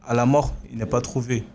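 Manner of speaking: ordinary talk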